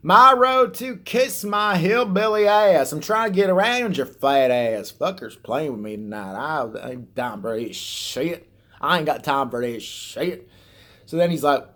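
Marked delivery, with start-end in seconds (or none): 0.1-4.9 loud, slow hillbilly road rage; high, drawn-out mock hillbilly yell; hillbilly accent. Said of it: "I can't understand a word he's saying". 4.9-10.5 slow hillbilly grumbling; drawn-out mock Southern hillbilly drawl; hillbilly accent. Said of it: "I can't understand a word he's saying".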